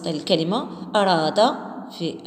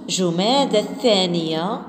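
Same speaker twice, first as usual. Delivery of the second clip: slow model reading; 3 semitones lower, slower than usual. Shown as a demonstration. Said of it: "كرروا أبنائي إعادة هذه الكلمات".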